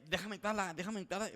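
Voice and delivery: high, pleading and frantic; desperate plea. Retold or imitated. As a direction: beg to get in, desperate. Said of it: "pero desesperado". (súplica desesperada y aguda)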